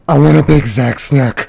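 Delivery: slow, loud yelled order; retold scream. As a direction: bellow the order out slowly. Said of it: "She screamed at me".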